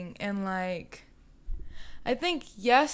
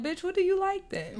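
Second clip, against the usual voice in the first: incredulous mock question; 9 semitones higher than usual. Mocking a man's makeover demand.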